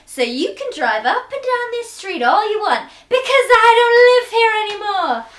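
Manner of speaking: high, loud, building to a yell; acted angry tirade. Acting out the break-up scene.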